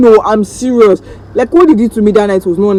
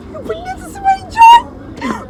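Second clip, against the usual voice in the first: squeaky high incredulous; slower than usual. Real, not acted. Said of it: "rhetorical playful".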